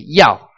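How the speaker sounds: Yao sharply dropping, loud like shouting; short falling shout on Yao. Shown as a demonstration. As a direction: bark Yao downward at someone. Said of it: "it's like you're shouting at somebody".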